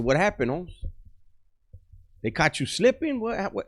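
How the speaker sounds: can't believe someone got him; surprised questioning. Retold or imitated. Youngster asks the veteran who caught him slipping, pitched high in disbelief. Eager to hear the story.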